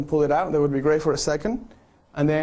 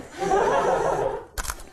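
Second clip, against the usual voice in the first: breathy high burst of laughter; high airy laugh. Real, not acted. Photographer cracking up at the last shot, high airy laugh. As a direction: laugh along with the group's big take.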